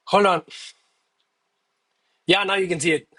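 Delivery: normal talking voice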